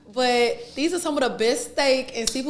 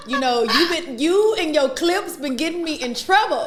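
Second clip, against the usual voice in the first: ordinary delivery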